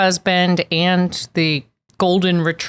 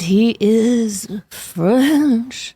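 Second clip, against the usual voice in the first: hammed-up slow French accent; slightly higher, drawn-out mock French; 4 semitones higher than usual. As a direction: put on a stretched French suitor accent. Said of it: "that's your best accent".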